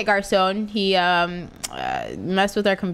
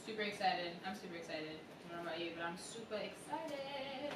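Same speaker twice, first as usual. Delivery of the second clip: softer, flat ironic excitement; deadpan; 23 dB quieter than usual. Pretend. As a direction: say it with no excitement at all.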